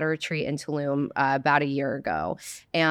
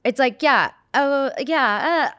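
higher, louder mock California girl; Valley girl accent; 8 semitones higher, 4 dB louder, much slower than usual. Shown as a demonstration. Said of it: "all the California girls are gonna hate us".

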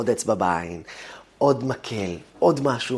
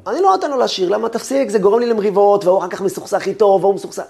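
exasperated parent; 9 semitones higher, 7 dB louder, faster than usual. Retold or imitated. Parent refusing child to sing.

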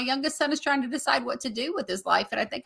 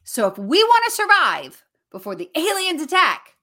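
hyped, higher and louder countdown; excited; 6 semitones higher, 6 dB louder than usual. Retold or imitated. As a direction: build the panic for the kids. Mom hyping alien threat.

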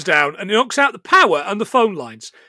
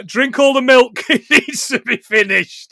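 slow, higher mum voice; 4 semitones higher, 6 dB louder, slower than usual. Retold or imitated. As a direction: mum insisting before a trip. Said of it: "I loved the line, by the way, from the mum".

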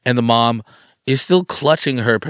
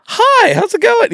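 high nervous greeting; 8 dB louder than usual. Retold or imitated.

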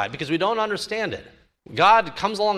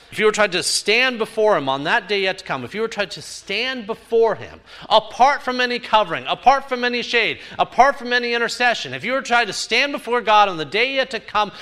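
preaching at full force; 6 semitones higher than usual. Acted. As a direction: preach it harder and harder. Preacher building to climax.

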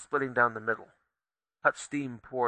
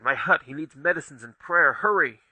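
urgent cry; 2 semitones higher, 5 dB louder than usual. Acted. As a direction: hurry them along, louder at the end. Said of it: "Tay cried".

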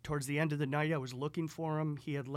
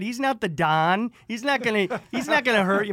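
loud, high-pitched imitation of an exasperated father; screaming dad; 8 semitones higher, 14 dB louder than usual. Dad insisting the stranger is harmless, screaming. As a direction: shout it like an old-time Italian dad. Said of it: "And my dad screams at me".

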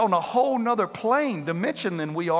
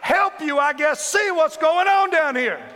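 high shouted complaint to God; telling God off; 9 semitones higher, 4 dB louder than usual. Acted. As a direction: yell it up at heaven.

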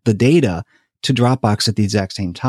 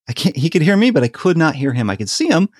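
usual speaking voice

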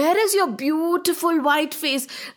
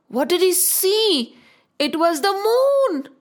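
ordinary delivery

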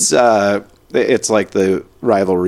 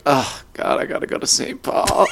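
high, mock whiny complaint; mock complaining; 5 semitones higher than usual. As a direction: whine it in mock dread. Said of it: "it seems like".

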